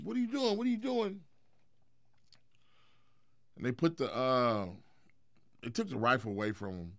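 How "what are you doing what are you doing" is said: high-pitched imitation of worried onlookers; pleading bystanders. Onlookers trying to stop a man with a rifle, pleading. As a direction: plead in a raised, mimicking voice.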